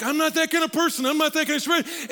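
high, loud mock protest; prim reluctant man. Reserved churchgoer protesting, high and loud. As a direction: whine that you're not the type. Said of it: "Some of you are like".

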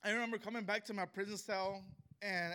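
ordinary talk, nothing marked

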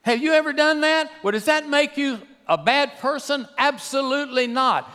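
impassioned sermon climax, pitched high; full-force preaching. Real, not acted. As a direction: drive it home to the congregation.

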